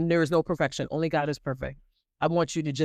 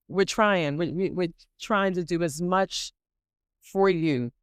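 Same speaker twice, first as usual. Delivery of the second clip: ordinary talk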